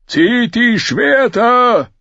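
slow, drawn-out battle shout; loud, high-strained roar; roaring command. A narrator's reading. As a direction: bellow the order to arms. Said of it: "brüllte Joren".

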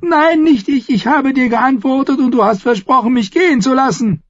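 very high, loud, desperate cry; screeched protest. A narrator's reading. Captive protests a broken promise, screeching desperately. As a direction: shriek the protest in panic. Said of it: "kreischte Merritt laut".

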